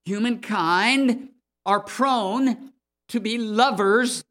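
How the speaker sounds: loud, slow preaching, each phrase hammered out; forceful preaching. Real, not acted. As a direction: preach it hard, stretching the key words.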